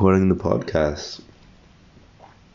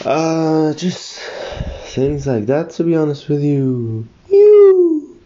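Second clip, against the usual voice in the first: much higher voice, rising to a loud squeal on the last word; high-pitched test voice; 11 semitones higher, 5 dB louder, slower than usual. Shown as a demonstration. Podcaster testing whether the frequency goes higher, high-pitched. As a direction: push the voice way up high, ending in a piercing held note. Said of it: "the frequency would go higher and lower".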